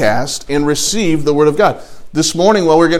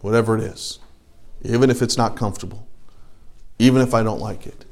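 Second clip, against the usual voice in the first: quiet imagined prayer; 6 semitones lower, 7 dB quieter than usual. Pretend.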